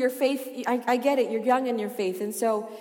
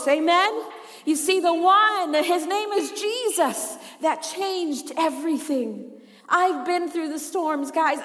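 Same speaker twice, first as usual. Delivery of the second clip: impassioned preaching; 5 semitones higher, 5 dB louder than usual. Real, not acted. Preacher declaring faith in Jesus to the church, impassioned preaching.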